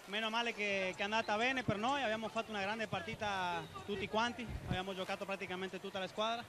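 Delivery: ordinary delivery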